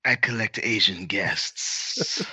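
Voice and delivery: slow, sinister villain imitation; creepy villain voice. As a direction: drawl it like a creepy fantasy villain. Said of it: "You sound like a, like a fantasy villain".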